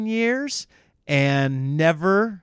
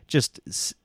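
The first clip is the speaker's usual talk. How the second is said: ordinary delivery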